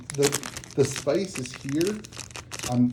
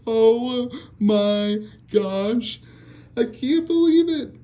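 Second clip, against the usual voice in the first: much higher and louder, drawn out slowly; stunned with happiness; overjoyed disbelief; 10 semitones higher, 5 dB louder, much slower than usual. Real, not acted. Can't believe the pull.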